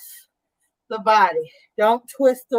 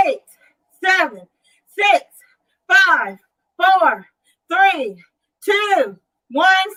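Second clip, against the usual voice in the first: upbeat rep countdown, count it out; aerobics instructor; 5 semitones higher, slower than usual. Real, not acted. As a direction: call the count like a fitness coach. Counting reps.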